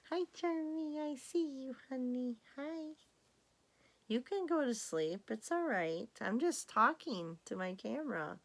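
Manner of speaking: high tender pet voice; sweet talk to cat. Real, not acted. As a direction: coo at the sleepy cat so she settles. Talking sweetly to her cat.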